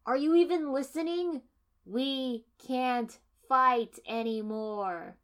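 high, loud, slow exasperation; exasperated. A narrator's reading. Kiba to the heroine, high and loudly exasperated. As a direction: make her listen, fed up.

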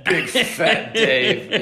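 mock British accent, high, slow and loud. Imitating the English leaguemate's taunt in a British accent. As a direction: drawl it like a taunting Brit. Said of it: "calling me in a British accent".

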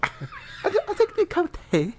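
high-pitched, badly faked UK accent; squeaky send-up of a UK voiceover. Asked for his best accent, a hopeless high UK attempt. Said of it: "Give us your best U K voiceover accent".

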